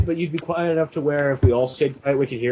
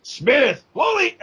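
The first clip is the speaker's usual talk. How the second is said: military drill voice; 8 semitones higher, slower than usual. Retold or imitated. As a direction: call names like a strict drill instructor. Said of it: "tough military voice".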